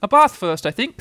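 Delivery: high, cheery mother; brisk, cheerful bath-time announcement. A narrator's reading. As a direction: sing it out cheerfully to a child. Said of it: "in a cheerful voice".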